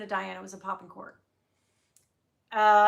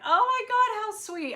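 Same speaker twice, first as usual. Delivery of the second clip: flattered squeal; 11 semitones higher, 6 dB louder than usual. Retold or imitated. Retold own flattered reaction.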